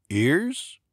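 puzzled question rising high on Ears; confused echo, rising pitch. A narrator's reading. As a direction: repeat it back, baffled. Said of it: "Fred sounded confused".

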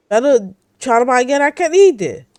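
loud, indignant scold. Real, not acted. Player scolding a rude creature in the game, loud.

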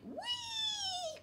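long high sliding whee; gleeful whee. Acted. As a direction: swoop up like on a slide. Snail slides, storyteller sound.

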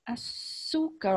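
slow, stretched-out classroom reading of Açúcar; teacher sounding out Açúcar slowly. Shown as a demonstration. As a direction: draw out each syllable of Açúcar for young pupils. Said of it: "a professora vai ler devagarzinho".

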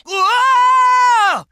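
drawn-out whoa, high and loud; long loud whoa. Acted. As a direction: yell it out as you leap. Battle cry in combat.

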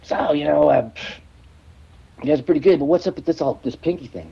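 badly attempted Boston accent, needling. Boston local quizzing a singer about his pinky, attempted Boston accent. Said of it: "I can't do the Boston accent".

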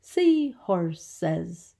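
"Seahorses" stretched into three distinct beats; syllable by syllable. Shown as a demonstration. Teacher counting syllables for children, "Seahorses" divided in three. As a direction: measure out each syllable of "Seahorses". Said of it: "Three syllables".

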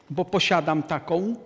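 usual speaking voice

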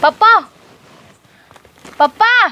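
calling to wake a sleeping father. Acted. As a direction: call out Papa, voice lifted. Daughter calls sleeping father awake.

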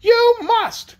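high, loud, imperious insistence; insistent cry. A narrator's reading. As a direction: cry out the order at high pitch. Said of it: "cried Anne".

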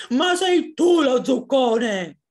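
loud, slow, high shout; angry drawn-out fox retort; yelling round fox. A narrator's reading. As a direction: yell it back, stretching the words. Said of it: "urlò Volpe Tonda".

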